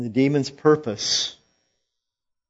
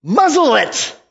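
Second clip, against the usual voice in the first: high, loud rebuke; fierce command; 13 semitones higher, 7 dB louder than usual. Acted. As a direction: snap the order with force. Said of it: "That's how fierce he is".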